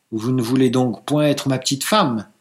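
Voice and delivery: ordinary delivery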